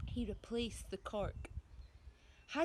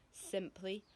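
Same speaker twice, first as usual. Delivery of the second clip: usual speaking voice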